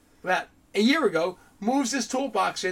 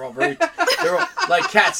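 laughing; 12 semitones higher, 7 dB louder than usual. Real, not acted. Laughing about territorial women.

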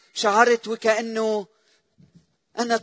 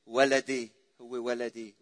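softened grieving mother; 8 semitones lower, 7 dB quieter than usual. Retold or imitated. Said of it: "لا شك أنها قالت".

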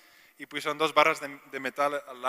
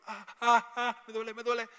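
high-pitched mock cries of pain; imitated pained yelps, pitched way up; woman in pain; 8 semitones higher than usual. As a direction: act out a patient yelping that it hurts. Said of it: "Y la mujer como".

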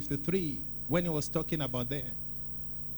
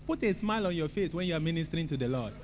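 ordinary talk, nothing marked

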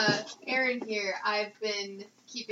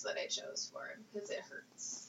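whispered aside; whispering; 14 dB quieter than usual. Real, not acted. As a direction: drop to a breathy whisper. Joking aside about straining voice.

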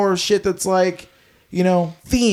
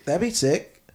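usual speaking voice

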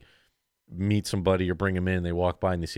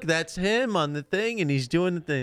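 mock relative explaining; 11 semitones higher, slower than usual.

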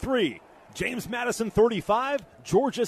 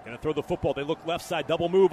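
usual speaking voice

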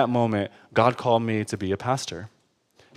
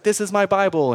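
lightly voiced upbeat preacher, pitched up; a hint of televangelist pep, higher voice; mock charismatic preacher; 7 semitones higher than usual. Preacher showing twenty thousand people his Bible, mock charismatic. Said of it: "like, all charismatic".

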